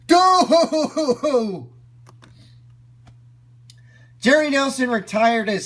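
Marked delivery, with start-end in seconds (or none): 0.1-1.1 hooting laugh demo. Shown as a demonstration. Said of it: "their laugh went from ... to".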